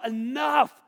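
loud, high, furious shout; imagined Jesus rising up in a loud furious shout; imagined furious outburst. Pretend. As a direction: roar as if finally fighting back. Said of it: "Why didn't Jesus go".

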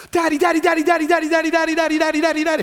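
put-on high, loud, nagging child voices over and over; clamouring kids. Children pestering their dad, high and loud. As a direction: whine it like a pack of kids tugging at dad.

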